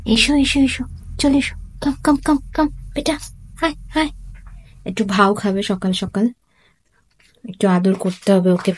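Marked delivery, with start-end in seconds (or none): 0.1-4.1 high, soft coaxing call. Real, not acted. Mother softly coaxing her pet to come, high and hushed. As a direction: gently coax the pet over.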